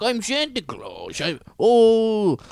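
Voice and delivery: high, slow mock Russian accent; Russian Santa accent. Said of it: "he's doing a Russian accent".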